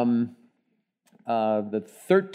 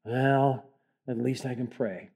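softer, resigned, falling flat on pray; defeated voice; 3 semitones lower, 7 dB quieter than usual. Shown as a demonstration. Pastor mocking the hopeless way people pray. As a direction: let it sink like a requiem. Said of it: "don't say it like that".

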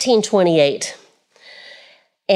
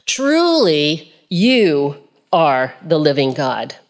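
a touch of reverent awe; 3 semitones lower, slower than usual. Acted. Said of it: "worshiped him, saying".

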